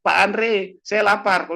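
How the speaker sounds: high, loud yelled complaint; hungry yell. Retold or imitated. Old patient yells again to Pak Andre for food, high and loud. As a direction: yell for food across the house. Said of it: "teriak lagi".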